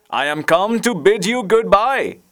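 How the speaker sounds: high, loud farewell call; crying out. A narrator's reading. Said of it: "he cried".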